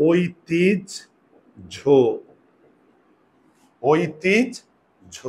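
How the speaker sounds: ঐতিহ্য stretched very slowly as oitijjho; teacher sounds out ঐতিহ্য slowly; slow drawn-out pronunciation. Shown as a demonstration. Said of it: "তাহলে কি হবে?". (ঐতিহ্য ধীরে টেনে উচ্চারণ)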